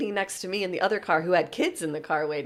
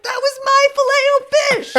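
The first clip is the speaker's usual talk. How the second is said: high, loud, laughing imitation of an indignant customer; irate customer protesting her lost order; 7 dB louder than usual. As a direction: whine it like a wronged customer. Customer arguing with worker.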